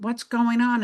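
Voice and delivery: anxious question. Retold or imitated. Teacher following the principal to his office, alarmed. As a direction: demand it with rising worry.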